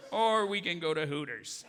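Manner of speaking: ordinary talk, nothing marked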